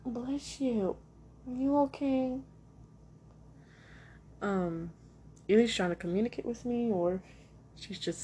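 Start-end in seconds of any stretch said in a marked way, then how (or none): 0.1-2.4 high, gentle pet-talk voice; sweet coo to an animal. Real, not acted. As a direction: check tenderly on a sneezing cat, voice lifted. Cooing to a sneezing cat.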